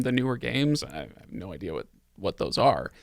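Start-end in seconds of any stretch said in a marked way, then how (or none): none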